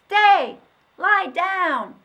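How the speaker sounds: put-on high, very loud child commands; children shouting. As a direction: yell each order at the dog. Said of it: "they shouted".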